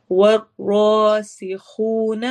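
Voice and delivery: والراسخون slow, ra heavy, lips rounded. Shown as a demonstration. Teacher reciting for the class. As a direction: recite والراسخون slowly, thick ra, whistling seen. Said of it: "روا کو موٹا پڑھنا ہے".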